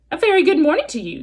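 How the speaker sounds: robot hailing the goose over the pond; friendly booming greeting. Acted. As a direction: boom it cheerfully across the water. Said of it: "the robot's friendly voice boomed".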